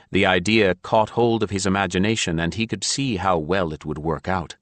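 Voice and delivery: usual speaking voice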